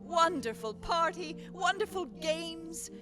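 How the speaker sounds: ordinary delivery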